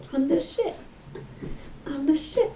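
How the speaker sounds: high, cocky little boast; mock swagger. Vlogger admiring the costume, playful swagger. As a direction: lift it high, showing off playfully.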